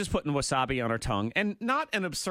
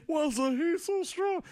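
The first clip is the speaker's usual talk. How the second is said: imitating quote; 11 semitones higher than usual. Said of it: "That quote".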